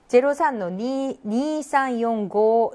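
digits read out with "니" and "고" held long; drawn-out digit reading. Shown as a demonstration. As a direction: spell out each digit, lengthening "니" and "고". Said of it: "이렇게 길게 느려서".

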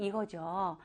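ordinary talk, nothing marked

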